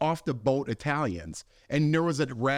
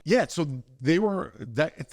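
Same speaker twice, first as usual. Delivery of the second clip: ordinary talk, nothing marked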